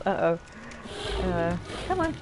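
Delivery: ordinary delivery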